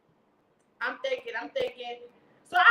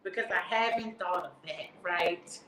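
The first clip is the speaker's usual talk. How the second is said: lower, dry sarcasm; sarcastic aside; 9 semitones lower, 4 dB quieter than usual. Real, not acted. Mocking the operator's locksmith advice, low sarcastic aside. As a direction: mutter the sarcasm low to the audience.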